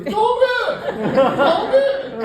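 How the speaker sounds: high, loud, drawn-out forced cheer; manic cheer. Retold or imitated. Told of a tumor, he beams it's all good anyway. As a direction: shout it out with forced glee. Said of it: "If the doctor tells me I got a tumor".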